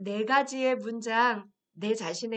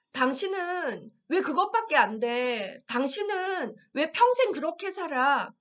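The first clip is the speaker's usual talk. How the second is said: mock accusation; 5 semitones higher, 4 dB louder than usual. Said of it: "누군가 나에게 비난을 했어요".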